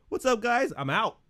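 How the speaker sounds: high, breezy mock greeting; mock Benjen. Cohost mocking a character's quick exit, high and breezy. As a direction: toss it off like a guy leaving. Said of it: "Benjen was like".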